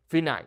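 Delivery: Final as fee-NAHL; Spanish "Final" joined up; Spanish pronunciation. Shown as a demonstration. Teacher returns to the word, Spanish Final. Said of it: "focusing on the vowel sounds".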